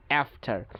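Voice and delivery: American "after" as AF-ter; cat vowel in "after", R sounded; American pronunciation. Shown as a demonstration. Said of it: "আমেরিকানরা বলবে". (after-এ অ্যা, American উচ্চারণ)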